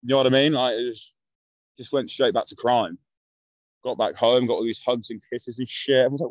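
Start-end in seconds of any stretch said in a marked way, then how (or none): none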